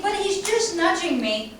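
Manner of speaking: defensive owner. Retold or imitated. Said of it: "And you think".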